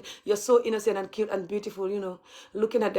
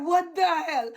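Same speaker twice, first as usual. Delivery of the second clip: mocking their reaction; 9 semitones higher, slower than usual. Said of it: "They like".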